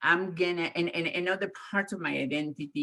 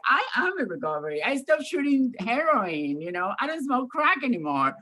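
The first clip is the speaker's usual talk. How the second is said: defensive retort; 10 semitones higher, 3 dB louder than usual. Retold or imitated. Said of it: "I'm like".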